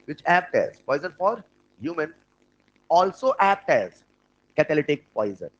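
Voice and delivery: very slow, word-by-word dictation. Shown as a demonstration. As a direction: spell it out so they can write. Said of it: "लिखना".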